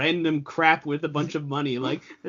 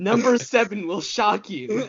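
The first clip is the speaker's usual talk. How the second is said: slow, dramatic teaser voice; clickbait video narrator; 5 semitones higher than usual. Pretend. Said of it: "start naming all of our videos".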